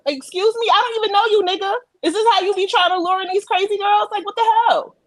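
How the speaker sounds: high, indignant disbelief. Real, not acted. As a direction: rant in outraged disbelief. Indignant rant.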